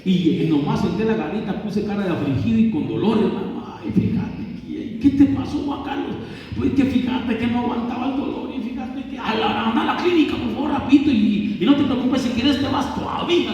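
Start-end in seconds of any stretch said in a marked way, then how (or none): 3.8-6.2 worried boss. Acted. Jefe preocupado pregunta. 6.6-13.5 fussing boss. Acted. Jefe manda a la clínica.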